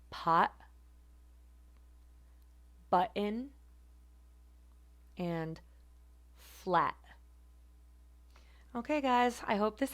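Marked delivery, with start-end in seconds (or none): 0.1-7.0 "pot", "button", "flat" each with a glottal catch; glottal stop examples. Shown as a demonstration. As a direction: end each t in the throat. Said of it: "examples of that again are".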